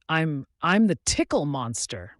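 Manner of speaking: usual speaking voice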